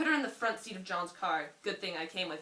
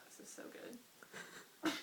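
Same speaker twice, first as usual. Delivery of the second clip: whispered; 7 semitones higher, 13 dB quieter, much slower than usual. Real, not acted. Aside after reading cliffhanger.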